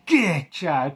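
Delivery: sudden mock exclamation at a cat; a hint of a character startling a cat; Albert impression. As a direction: imitate a lightly voiced, abrupt catchphrase. Imitates Albert's sudden cry at cat.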